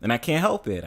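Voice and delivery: normal talking voice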